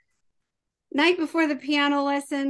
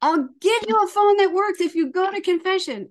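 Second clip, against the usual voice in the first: bargaining mom; 7 semitones higher, 6 dB louder than usual. Retold or imitated. Mom pleading with son.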